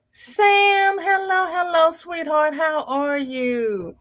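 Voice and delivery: high, drawn-out welcome, slowing on the question; sing-song greeting. Real, not acted. Host greeting Sam on the livestream, sing-song and high. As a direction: croon a hello to a favourite viewer.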